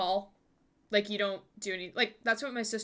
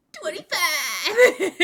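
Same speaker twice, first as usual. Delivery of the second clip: slow loud laughing shout; 7 semitones higher, 9 dB louder, much slower than usual. Pretend. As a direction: yell it out while laughing. SpongeBob meme punchline shout.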